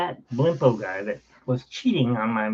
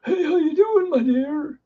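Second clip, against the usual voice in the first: much higher, sing-song mock of a smarmy greeting; mocking imitation; 4 dB louder than usual. Mocking mom's boyfriend greeting him, high and smarmy. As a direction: ham up the creep's sugary hello. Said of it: "You know, say".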